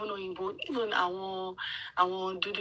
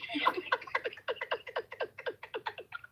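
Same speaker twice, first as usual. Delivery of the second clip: high-pitched burst of laughter; 5 dB quieter than usual. Real, not acted. Laughing at her own story.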